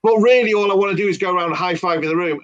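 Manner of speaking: ordinary talk, nothing marked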